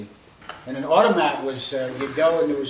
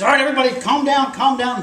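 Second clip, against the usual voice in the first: loud, raised call for order; commanding doctor; 10 semitones higher, 9 dB louder than usual. Retold or imitated. Storyteller voices doctor calming the crowd, loud commanding call. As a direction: take charge over a panicking crowd. Doctor takes charge in retold scene.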